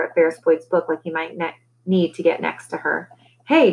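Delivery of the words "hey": sharp, mild snarl on one word; lightly snarled call. A narrator's reading. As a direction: snap it as a short warning. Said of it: "Deli snarled".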